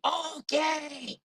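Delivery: high, strained, drawn-out cry. Acted. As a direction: push it up high, mock-hushed shouting. Corrects it back to loud whisper.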